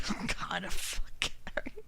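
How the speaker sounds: slow whispered aside; hushed slow joking curse. Real, not acted. Joking aside about Gary.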